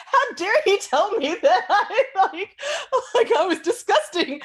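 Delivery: high, loud mock outrage broken by laughs; laughing indignation. Laughing while retelling the guru calling her disgusting. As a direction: replay the old offense, laughing at it now. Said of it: "and I'm just like".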